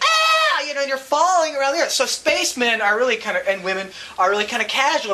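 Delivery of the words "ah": sudden high shriek of alarm; scream. Pretend. Your inside screams while falling, short high shriek. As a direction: let out a sharp, very high scream. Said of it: "And your inside's like".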